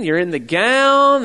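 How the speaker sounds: ordinary delivery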